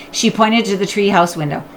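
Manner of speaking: usual speaking voice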